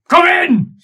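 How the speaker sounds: very high, loud yelled command; shout. Acted. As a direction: yell it through the door. Shout from inside the office.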